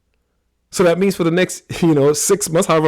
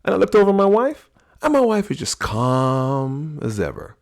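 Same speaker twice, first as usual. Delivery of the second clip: normal talking voice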